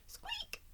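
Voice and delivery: tiny squeaky squeak; high mouse squeak. Acted. Mouse wakes in story.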